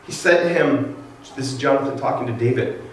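ordinary talk

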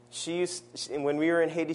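normal talking voice

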